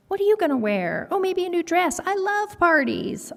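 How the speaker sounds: high, gushing schoolgirl voice; girly classmate. Acted. Girl classmate gushes about her party dress, high and girly. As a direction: play a girl dreaming of a new dress.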